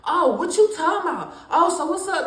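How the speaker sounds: lightly voiced defensive mother; mother getting defensive. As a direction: imitate mom snapping back defensively. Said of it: "So she got mad".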